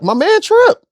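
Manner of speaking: high, fond call-out; excited. Real, not acted. Praising Trip, a sudden high excited call. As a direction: hype his man Trip.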